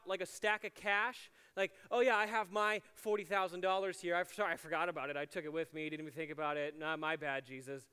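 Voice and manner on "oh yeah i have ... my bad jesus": mock sarcastic. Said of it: "They respond very sarcastically".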